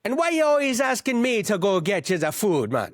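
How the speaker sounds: put-on sending reggaetoni for gabagool, Jersey Italian accent; New Jersey Italian accent. Jersey voice complaining.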